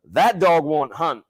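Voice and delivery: slow, punched Southern drawl; Southern twang, slow and emphatic; Southern accent. Retold or imitated. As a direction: quote it in a loud, drawn-out Southern accent. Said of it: "don't do your Southern accent".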